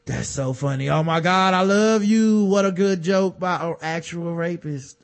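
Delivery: hammed-up crowd adoration, building then easing; mock adoring audience. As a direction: gush like a besotted fan, dripping with sarcasm. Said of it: "And everybody's like".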